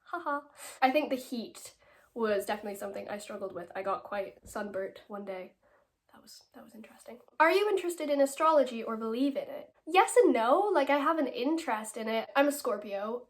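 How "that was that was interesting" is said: whispered aside; confiding whisper. Real, not acted. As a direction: drop to a whisper for the joke. Joking aside about sunburn.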